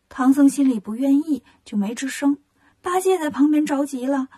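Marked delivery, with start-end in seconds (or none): none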